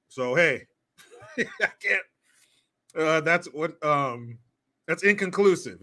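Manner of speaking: ordinary talk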